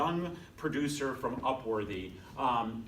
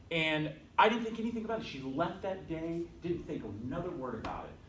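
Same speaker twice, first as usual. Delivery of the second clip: ordinary delivery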